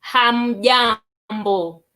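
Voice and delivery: Hamjambo drawn out slowly; slow demo. Shown as a demonstration. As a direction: lead the class through hamjambo. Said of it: "let's try it together".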